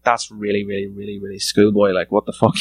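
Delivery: ordinary talk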